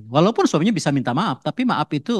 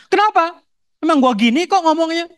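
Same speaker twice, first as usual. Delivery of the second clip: much higher, louder angry shout; angry outburst; 4 dB louder than usual. Acted. Husband exploding at wife over a simple question, shouting. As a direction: blow up defensively.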